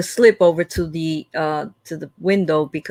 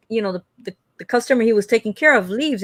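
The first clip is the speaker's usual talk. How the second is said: usual speaking voice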